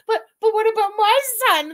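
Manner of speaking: high, loud pleading as a crazy mom; crazed mother. Acted. Mother begging neighbors for her son, crazed mock plea. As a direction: plead like a frantic, mocked mother. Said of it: "like she's fucking crazy".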